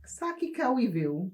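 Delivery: high-pitched imitation of mom in Patois. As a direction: exclaim in Patois like a baffled mother. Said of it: "She said it in Patois".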